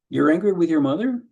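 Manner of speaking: rising at the end, a touch surprised on angry; question rise. Shown as a demonstration. Counselor questioning a client, rising at the end. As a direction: lift mother at the end as a question. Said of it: "almost communicates you shouldn't be feeling that way".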